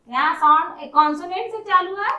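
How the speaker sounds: normal talking voice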